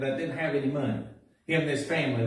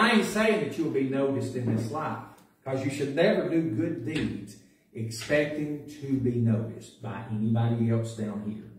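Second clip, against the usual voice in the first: normal talking voice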